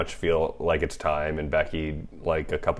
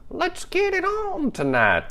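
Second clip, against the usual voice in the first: put-on high, slow, creepy old man croon; creepy grandpa voice. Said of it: "I just made, like, a creepy old grandpa voice there".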